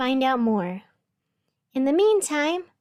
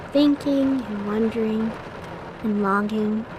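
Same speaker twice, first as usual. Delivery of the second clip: dreamy reverie; 2 semitones lower than usual. Acted. Dreamy narrated train reverie.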